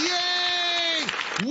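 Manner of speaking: high, sarcastic fake cheer; mock cheer. Host mocking the governor, sarcastic high cheer.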